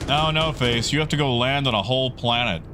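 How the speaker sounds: ordinary talk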